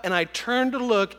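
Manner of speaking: ordinary delivery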